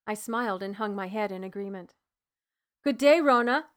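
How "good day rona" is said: curt, higher and louder brush-off greeting; impatient. Acted. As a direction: cut the pleasantries short. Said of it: "Laverne said impatiently".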